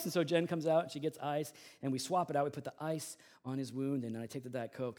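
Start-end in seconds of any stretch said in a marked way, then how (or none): none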